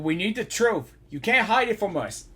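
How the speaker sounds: ordinary talk, nothing marked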